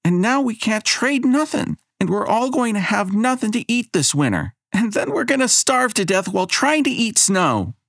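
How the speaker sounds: put-on child shouting. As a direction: rant it all out in one breath. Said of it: "Abigail shouts".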